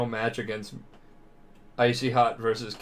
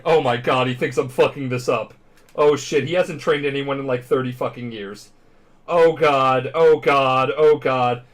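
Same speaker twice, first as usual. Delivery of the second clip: mock panic; 2 semitones higher, 7 dB louder than usual. All Might panicking about trainer.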